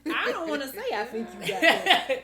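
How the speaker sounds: high, loud protest with laughter; laughing admission. Real, not acted. Therapist admits reluctance to diagnose, laughing and loud. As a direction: insist loudly while laughing.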